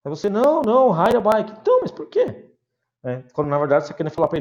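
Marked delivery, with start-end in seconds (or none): 0.3-1.5 insisting student. Pretend. Student insisting in role-play. 1.6-2.5 puzzled foreigner. Pretend.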